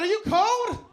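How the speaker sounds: high, loud shouted question; loud, high-pitched disbelief; mock incredulous holler. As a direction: call it out to the stage. Acted yell at shivering stripper.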